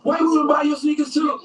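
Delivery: hammed-up outraged critic; mock angry shout. Critics yelling at LeBron, mock-angry shout. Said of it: "they fucking went off on him".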